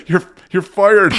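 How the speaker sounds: a strained you're fired pushed out through laughter; laughing, breathless. Retold or imitated. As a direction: try to fire her but laugh too hard. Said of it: "I couldn't even get it out 'cause my chest hurt".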